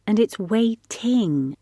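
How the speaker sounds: WAITING drawn out with a clearly sounded -ing; correcting pronunciation. A narrator's reading. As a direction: correct him by saying "waiting" slowly, every sound in place.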